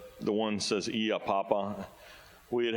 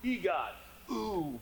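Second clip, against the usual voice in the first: imitating a Bigfoot call; 8 semitones higher, much slower than usual. Retelling what the creature said, mimicking its yelp. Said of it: "you hear one of 'em say".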